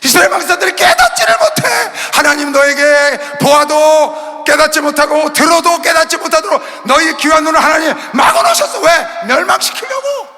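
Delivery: high, loud, slow hammering sermon; shouted rebuke to the congregation; fiery preaching. Acted. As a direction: thunder it from the pulpit. Preaching at full force.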